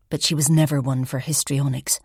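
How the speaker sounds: ordinary talk, nothing marked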